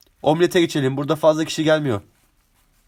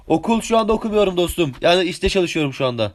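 normal talking voice

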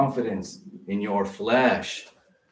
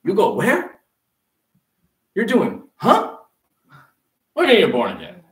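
high, loud baffled family member; mock shocked relative; incredulous relative; 9 semitones higher, 8 dB louder than usual. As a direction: question it in disbelief, pitch jumping up. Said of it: "have family and say".

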